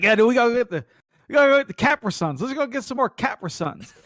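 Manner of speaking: high, fast insistent mock friend; teasing friend voice; imitating Flint.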